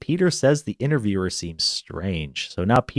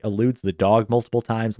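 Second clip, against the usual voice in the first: ordinary talk, nothing marked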